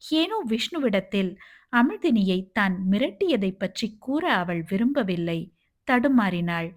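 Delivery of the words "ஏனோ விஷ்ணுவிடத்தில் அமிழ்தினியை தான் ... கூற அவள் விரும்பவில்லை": ordinary delivery, nothing marked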